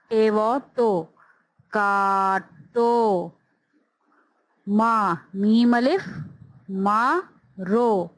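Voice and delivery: drawn-out letter-by-letter spelling for beginners; slow phonics spelling. Shown as a demonstration. Teacher spelling words aloud for young students, slow and deliberate. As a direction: sound out each letter slowly, then land the whole word.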